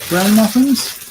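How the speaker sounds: surprised. Real, not acted. Shocked at bran muffins.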